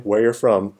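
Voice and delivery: usual speaking voice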